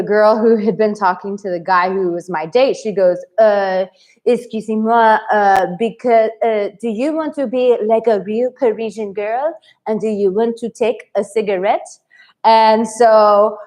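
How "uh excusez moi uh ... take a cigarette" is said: light imitation of a French accent. Parisian girl offers the American a cigarette, mild French accent.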